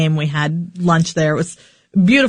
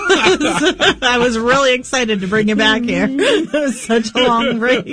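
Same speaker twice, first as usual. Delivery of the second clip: laughing; 7 semitones higher than usual. Real, not acted. Giggling after shouting the intro.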